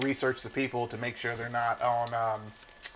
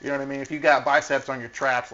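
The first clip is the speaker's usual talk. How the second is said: ordinary talk, nothing marked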